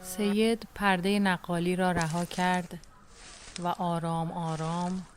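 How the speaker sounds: normal talking voice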